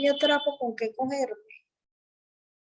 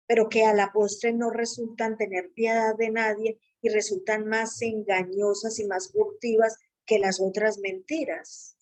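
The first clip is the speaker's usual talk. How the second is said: ordinary talk, nothing marked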